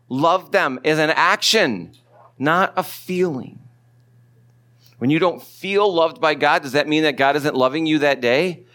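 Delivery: usual speaking voice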